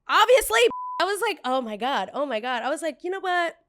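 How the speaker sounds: loud, high burst of exasperation; real temper flaring in the retelling; angry yelling. Real, not acted. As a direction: blurt it out at the edge of a yell. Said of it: "trying not to start yelling".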